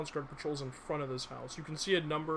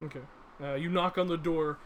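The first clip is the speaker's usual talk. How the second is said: usual speaking voice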